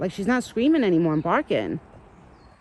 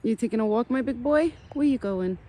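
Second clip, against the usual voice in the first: pet talk; 6 semitones higher than usual. Real, not acted. Owner cooing to her pet.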